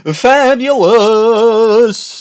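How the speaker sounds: high, very slow flamboyant crow; hyped-up showman. Acted. Showman correcting himself, drawn-out flamboyant crow. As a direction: stretch it out like a glam star. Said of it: "that hyped up persona".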